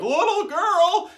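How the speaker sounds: high, loud, slow call; drawn-out high call to a little girl. Retold or imitated. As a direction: call her across the street. Homeless guy calls out to girl.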